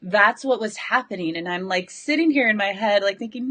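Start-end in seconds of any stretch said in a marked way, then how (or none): none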